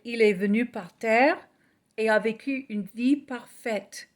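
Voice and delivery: ordinary talk, nothing marked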